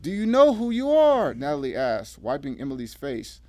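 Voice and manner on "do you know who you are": high, loud, pressing question; urgent questioning. A narrator's reading. Natalie asking revived Emily who she is, urgent. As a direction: press her urgently to respond.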